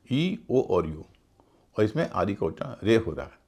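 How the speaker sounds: normal talking voice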